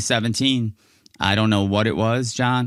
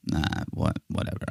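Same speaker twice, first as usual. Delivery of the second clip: whispered, half-conscious brush-off; a soft whispered shrug from a drugged patient; dazed mumble; 6 semitones lower, 8 dB quieter, faster than usual. Retold or imitated. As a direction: barely get it out, still half out of it. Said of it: "I'm still half out of it".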